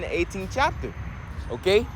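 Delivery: normal talking voice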